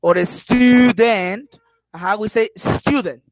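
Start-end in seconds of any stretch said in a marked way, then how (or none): 0.2-1.6 "student" drawn out very slowly, stuuu-DENT, loud; exaggerated wrong stress. Shown as a demonstration. Teacher showing how not to say it, slow overdone stress. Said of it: "We don't say, like". 2.5-3.2 "student" with STU stressed, short falling end; first-syllable stress. Shown as a demonstration. Teacher giving the class the correct word, stressed at the start. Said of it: "At the beginning, in the S T".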